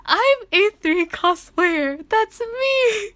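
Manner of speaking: loud, very high, drawn-out fan squeal; freaking out. Retold or imitated. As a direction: re-enact a thrilled shriek of being recognised. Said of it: "freaking out".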